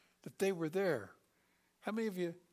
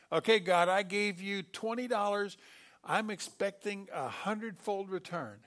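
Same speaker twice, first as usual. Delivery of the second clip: loud, higher mock-demanding prayer; greedy demand; 4 semitones higher, 6 dB louder than usual. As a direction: demand a payout from God. Mocking greedy prayer quote.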